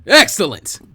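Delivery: big loud hearty "Excellent"; booming. Acted. Guy booming at Naruto to make him stand straight, loud. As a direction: boom it out like a proud teacher.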